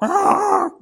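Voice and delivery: loud, high-pitched imitated squawk; harsh swan squawk. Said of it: "it would sound like".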